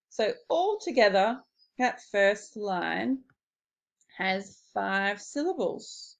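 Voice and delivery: ordinary talk, nothing marked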